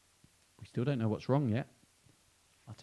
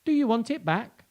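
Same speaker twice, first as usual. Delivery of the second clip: mouse voice; 11 semitones higher, 8 dB louder than usual. Acted. Mouse offers the tooth.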